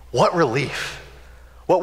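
usual speaking voice

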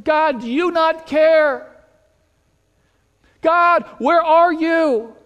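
high, insistent cry to God; anguished plea. Acted. Believers in hardship question God, anguished plea.

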